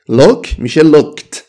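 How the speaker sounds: "looked" said as one syllable ending in a short t; "looked" as lookt, quick final t. Shown as a demonstration. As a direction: sound "looked" like lookt, no extra vowel.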